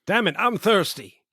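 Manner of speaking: high, laughing swagger; hammed-up villain voice; mock gangster. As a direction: toss off a casual taunt. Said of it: "So funny lines then".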